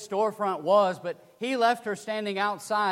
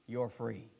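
soft, lower, steady words; calm quiet; 9 semitones lower, 11 dB quieter than usual. Retold or imitated. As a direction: calmly repeat it to her. He calmly repeats her freedom.

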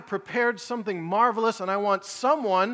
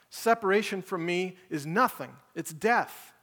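forceful preaching; 3 semitones higher than usual. Acted. Preacher voicing Jesus to congregation, forceful preaching. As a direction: hammer it home as Jesus, urgent.

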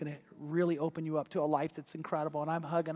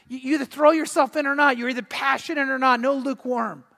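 high, raised, insistent sermon voice; impassioned preaching; 7 semitones higher, 7 dB louder than usual. Acted. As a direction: press the challenge on the congregation. Preaches an urgent challenge.

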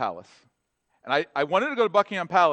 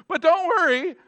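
high, loud "don't worry" burst; God reassuring; 13 semitones higher, 7 dB louder than usual. Acted. God breaking in to reassure Moses, high and loud. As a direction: reassure Moses with sudden lift.

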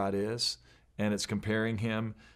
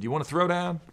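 higher-pitched mock challenge; challenging toddler; 7 semitones higher than usual. Two-year-old picking a fight with an adult, mock tough. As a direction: lightly voice a cocky little kid.

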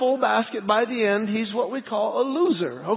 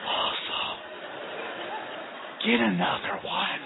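hushed encouragement; 6 dB quieter than usual. Retold or imitated. Dad answering from afar.

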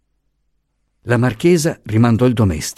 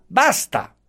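"basta!" pitched much higher as the last cut-off; final sharp basta; 14 semitones higher than usual. A narrator's reading. Commissioner silences the protesting professor, "basta!" high and final. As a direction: slam the door on him with "basta!". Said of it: "lo interruppe subito".

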